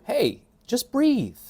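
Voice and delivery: high, drawn-out singsong reassurance; breezy mock advice. Mock advice to someone drowning.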